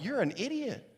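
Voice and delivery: high-pitched scolding disbelief; high, incredulous put-down; mock exasperation. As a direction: berate a fool in acted exasperation. Acting out the sailors' disbelief.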